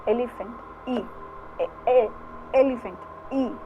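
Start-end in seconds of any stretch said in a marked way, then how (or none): 1.6-2.1 "ए" sounded alone, twice; teacher isolating the vowel "ए"; isolated opening vowel. Shown as a demonstration. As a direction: sound out "ए" as the first vowel of Elephant. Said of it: "की sound आ रही है".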